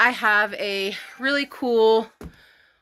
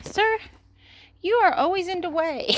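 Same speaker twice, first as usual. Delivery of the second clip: much higher-pitched mock reproach; playful pet scolding; 10 semitones higher than usual. Real, not acted. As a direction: tease the pet in a high voice. Talking to an animal underfoot.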